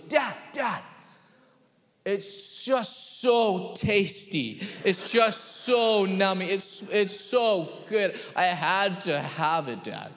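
put-on high, slow dreamy kid voice; blissed-out little boy. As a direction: savour every word like a four-year-old with sugar. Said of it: "finally he goes".

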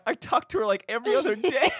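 high, loud laughing retort; laughing protest. Retold or imitated. Retold heated reply, laughing.

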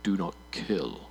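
slow, low, grave voice of God; solemn divine gravitas. Acted. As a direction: boom it out like God. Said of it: "I just want to add that gravitas".

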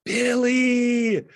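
high, loud, drawn-out holler; imitated scream. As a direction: imitate someone screaming a name down the street. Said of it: "would come by every day and scream".

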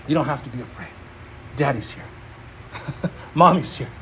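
soothing parent. Retold or imitated. Parent comforting scared child.

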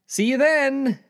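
very high, loud sign-off; put-on upbeat parting; cheery goodbye. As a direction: sing out a carefree farewell to a pal. Acted cheery goodbye between friends.

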